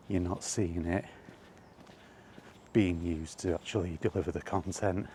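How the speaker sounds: ordinary talk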